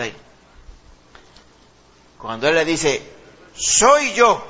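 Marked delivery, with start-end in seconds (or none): 3.5-4.4 louder, higher proclamation of identity; llamado proclamado. Acted. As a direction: call out high and strong. Jesús llama a los discípulos. (llamado fuerte y agudo)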